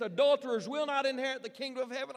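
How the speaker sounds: high, loud fiery preacher's thunder; preaching like a maniac. Retold or imitated. Evangelist warning the crowd, a loud fiery preacher's cry. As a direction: hammer the sinners from the pulpit. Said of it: "began to preach like a maniac".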